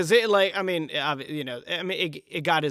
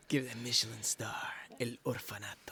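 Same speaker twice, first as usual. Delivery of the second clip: breathy whispered tagline; whispering; 10 dB quieter than usual. Pretend. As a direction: whisper it like a secret.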